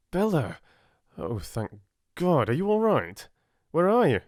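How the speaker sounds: anxious man finally reaching her; frantic relief. Acted. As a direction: burst out, desperate to find her. Frantic boyfriend finds her.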